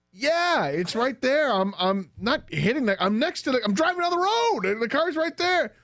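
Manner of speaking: high-pitched, exasperated arguing; defensive protest. Retold or imitated. Teen driver arguing back to dad, high defensive protest. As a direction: re-enact flustered self-defence, pitch way up.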